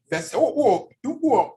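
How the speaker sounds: ordinary talk, nothing marked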